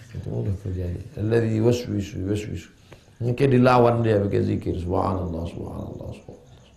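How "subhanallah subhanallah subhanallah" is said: repeated praise fading to near silence; murmured dhikr. Shown as a demonstration. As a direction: let each repetition sink softer. Demonstrates repeated dhikr fading away.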